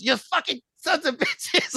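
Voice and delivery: high, drawn-out mock yell; imitated yelling. Host imitating Ricky yelling at little kids, mock yell. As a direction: mimic an outraged yell without real volume. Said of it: "he yells at the little kids".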